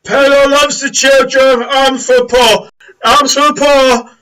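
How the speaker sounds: very high, loud, drawn-out alms cry; Crag. Acted. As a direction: belt the routine out to the crowd. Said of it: "Crag goes immediately into the same routine".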